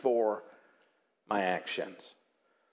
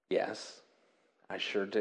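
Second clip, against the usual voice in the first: gentle assent; 7 semitones lower, 6 dB quieter than usual. Shown as a demonstration. Modelled reply forgiving someone.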